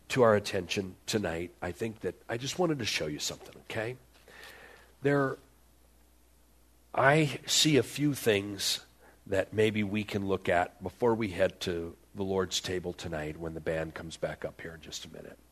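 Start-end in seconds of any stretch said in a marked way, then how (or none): none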